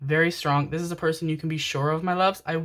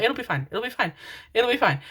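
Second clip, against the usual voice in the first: self-soothing; 5 semitones higher than usual. Real, not acted. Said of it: "no, no, it's be fi".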